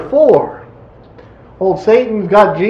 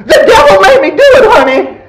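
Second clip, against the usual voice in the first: high, loud, fast comic squeal; Flip Wilson impersonation; 10 semitones higher, 5 dB louder than usual. Acted. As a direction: squeal out a sassy comic excuse. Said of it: "my best Flip Wilson impersonation".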